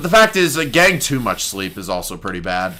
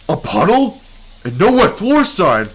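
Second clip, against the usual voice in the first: excited; 8 semitones higher, 4 dB louder than usual. Acted. Thrilled to find the puddle.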